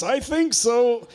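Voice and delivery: high, slow, strained answer; pained reply. Retold or imitated. Hurt Jerry answers slowly, high and pained. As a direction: squeeze it out in pain. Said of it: "And Jerry says".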